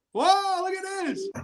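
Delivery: high, delighted whoa; surprised greeting. Real, not acted. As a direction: call out in sudden surprise. Reacts to arrivals interrupting his story.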